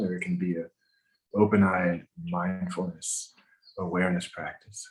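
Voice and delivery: calm, measured breathing instructions; walk them through each breath gently; guided meditation. Acted.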